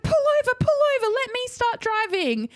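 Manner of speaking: high, fast panicked pleading; mother freaking out at the sight of cop lights; panicked mum. Retold or imitated. Mum panicking at husband, high and fast. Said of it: "Mum just starts freaking out".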